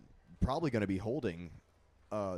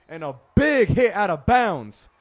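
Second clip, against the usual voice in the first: excited; 10 semitones higher, 15 dB louder than usual. Real, not acted. Big hit called in play-by-play.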